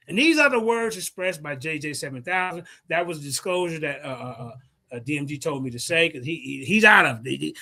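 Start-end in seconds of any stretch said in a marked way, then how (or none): none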